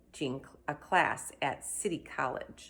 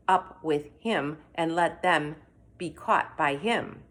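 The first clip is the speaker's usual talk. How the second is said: ordinary delivery, nothing marked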